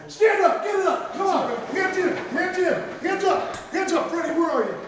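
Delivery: loud, high rallying calls; halftime pep speech. Real, not acted. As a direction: fire the team up.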